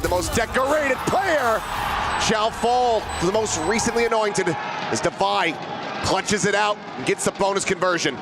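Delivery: rising, building esports caster shout; hype caster call. Real, not acted. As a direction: build to a big clutch call. Clutch round climax call.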